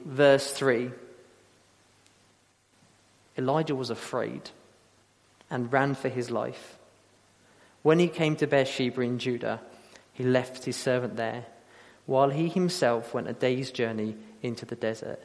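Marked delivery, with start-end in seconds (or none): 3.4-15.2 soft, measured reading aloud; solemn scripture reading. Acted. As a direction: read the passage quietly, letting the ending sink. We read this.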